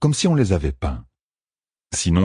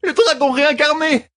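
very high frightened shout on dragon; fleeing woman names the monster in panic; panicked cry; 3 dB louder than usual. A narrator's reading. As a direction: fling out the name in terror. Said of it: "cria la fugitive".

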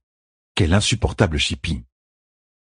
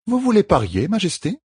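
higher, steady caregiver's voice; firm midwife; 13 semitones higher than usual. A narrator's reading. Midwife explains the treatment to the queen, firmly. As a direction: lay out the rules kindly but firmly.

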